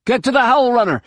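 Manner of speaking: high, urgent order; high-pitched battle command; shouting. A narrator's reading. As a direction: shout a comrade toward the ship. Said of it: "he shouted at Droma".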